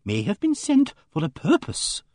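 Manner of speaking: high, earnest lady's voice; pious Emily Barton. A narrator's reading. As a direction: lift "sent for a purpose" high, ending upward. Pious lady voiced in story.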